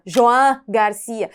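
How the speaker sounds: "João Garcia" said slowly and carefully, "João" as Catalan Joan with open final A, "Garcia" stressed on the I; Catalan name pronunciation. Shown as a demonstration. Presenter teaches the Catalan keeper's name, slow careful pronunciation. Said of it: "Então o nome dele é mesmo".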